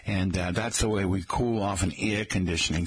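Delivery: everyday working Boston accent, R's dropped; casual townie Boston accent. Shown as a demonstration. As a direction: talk like a Boston local. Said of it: "as opposed to a Boston accent".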